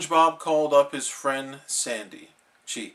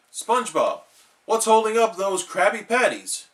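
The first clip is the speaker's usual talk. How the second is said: loud, higher gruff yell; yelling boss; 7 semitones higher, 4 dB louder than usual. Acted. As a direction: shout it through the kitchen. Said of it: "yelled Mister Krabs".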